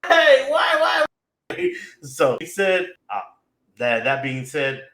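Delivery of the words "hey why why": loud, high, drawn-out cry; shouted, high-pitched fake anguish; mock despairing wail. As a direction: wail in hammed-up agony at a cliffhanger. Mock despair at episode's ending sound.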